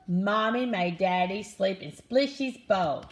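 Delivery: usual speaking voice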